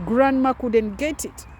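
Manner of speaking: ordinary talk, nothing marked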